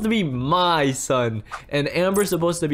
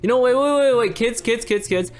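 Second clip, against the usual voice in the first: urgent calling out; 7 semitones higher than usual. Acted. Parent calling kids to stop.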